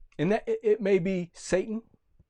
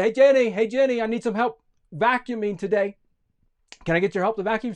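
lightly voiced grandma, pitched up; Grandma calling; 6 semitones higher, 5 dB louder, faster than usual. Grandma asking Jenny to vacuum, raised lightly acted voice. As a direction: call out for a helper.

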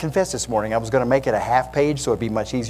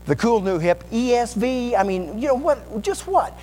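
bragging know-it-all; 8 semitones higher than usual. Acted. Preacher playing a bragging know-it-all.

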